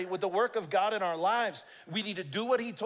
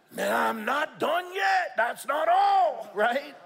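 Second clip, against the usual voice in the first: shouting; 5 semitones higher, 5 dB louder, slower than usual. Real, not acted. Preacher builds to a shout.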